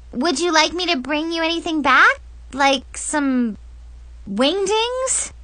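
put-on high girlish offer, leaping up at the end; perky question with a soaring final rise; over-eager offer. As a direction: offer it far too keenly. Girl offers snack, covering nerves.

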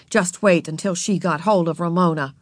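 usual speaking voice

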